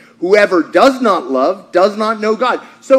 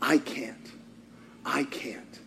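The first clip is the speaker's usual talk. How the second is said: much softer and lower; quiet; 8 semitones lower, 17 dB quieter, slower than usual. Acted. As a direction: let it fall away, barely audible. Repeated quiet admission.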